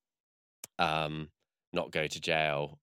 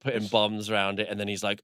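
ordinary delivery

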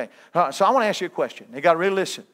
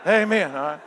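mama's amen; 5 dB louder than usual. Retold or imitated. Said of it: "Her mama's going".

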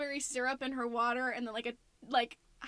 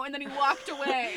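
ordinary talk, nothing marked

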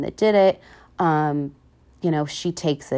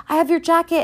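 much higher, eager voice for Finn; a fan voicing Finn, higher and eager; Finn, imitated; 11 semitones higher, 4 dB louder, faster than usual. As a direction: play Finn proudly showing the jacket. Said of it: "And he's like".

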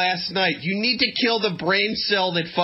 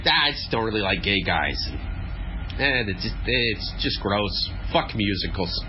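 lower, dopey dude voice; dumb homophobic dude; 9 semitones lower than usual. Retold or imitated. Voicing homophobic dude, camp one.

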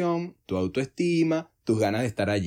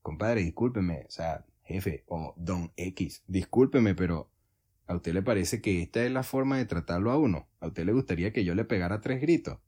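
soft, low, measured objection; calm and polite; 6 semitones lower, 5 dB quieter than usual. Acted. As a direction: politely stand up to the boss, keeping it quiet and low. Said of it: "tranquilito y de manera educada".

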